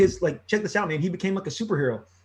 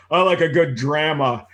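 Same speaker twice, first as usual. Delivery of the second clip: put-on Midwestern accent with "drama" said as "dramma", flat a; dad doing his Midwestern "drama"; 7 dB louder than usual. Son retelling dad's Midwestern "drama" at the car, mild Midwestern accent. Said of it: "these Midwestern, like, accents".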